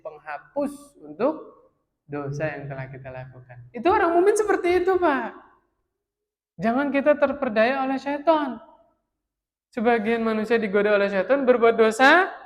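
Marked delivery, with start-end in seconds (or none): 3.7-12.3 loud, high, fervent sermon delivery; impassioned preaching. Real, not acted. Preacher rousing the congregation about human weakness, loud and high. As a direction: drive the point home to the hall.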